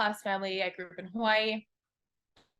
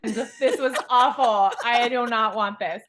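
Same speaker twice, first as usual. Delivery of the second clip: louder, hammed-up indignant customer; mock complaint; 3 semitones higher, 7 dB louder, slower than usual. She protests to life that success feels awful, mock outraged yell. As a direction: complain like demanding a refund. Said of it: "I'm like".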